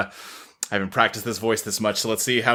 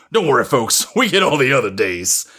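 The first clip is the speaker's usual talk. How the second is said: ordinary delivery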